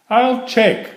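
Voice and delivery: put-on a young child keen to go; eager little turtle. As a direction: Franklin in a high childlike voice. Franklin answers Bear on phone.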